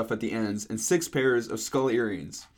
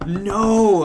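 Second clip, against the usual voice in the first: loud, high, drawn-out cry; scream; 7 semitones higher, 9 dB louder than usual. Acted. Horrified narrator reacts to Draco's news, loud drawn-out scream. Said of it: "I screamed".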